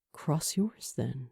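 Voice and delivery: quiet, sober and low; earnest and soft; serious. A narrator's reading. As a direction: drop all the playfulness. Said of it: "his voice losing all of its earlier playfulness".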